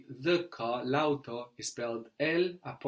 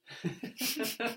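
laugh; 10 semitones higher, 4 dB quieter than usual. Real, not acted.

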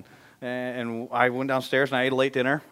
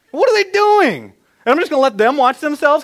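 incredulous; 12 semitones higher, 11 dB louder than usual. Real, not acted. Incredulous rhetorical question.

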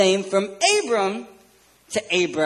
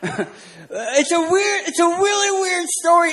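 laughing it out, much higher and louder; real laugh breaking into the line; 12 semitones higher, 4 dB louder, slower than usual. Real, not acted. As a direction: giggle through the words at the odd tale. Laughing over awkward Bible story.

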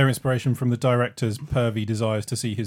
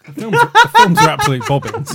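high and loud through laughter; laughing outburst; 8 dB louder than usual. Real, not acted. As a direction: burst out laughing as you say it. Laughing outburst about films.

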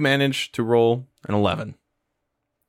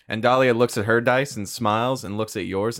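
normal talking voice

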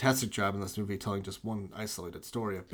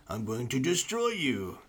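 slow, hokey gruff menace; mock deep villain voice; 3 semitones higher, slower than usual. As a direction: drag it out like a corny supervillain. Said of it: "like, a deep voice".

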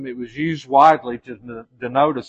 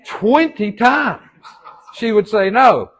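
exasperated; 9 semitones higher, 6 dB louder, slower than usual. Real, not acted. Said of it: "exasperated retelling".

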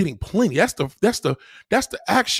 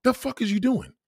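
ordinary talk

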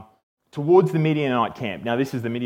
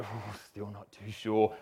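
soft, low, slow uncertainty; drawn-out, quiet wavering; hesitant doubt; 5 semitones lower, 13 dB quieter, slower than usual. Retold or imitated. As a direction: voice a sheepish doubter under the breath. Acting Gideon's doubt the next day.

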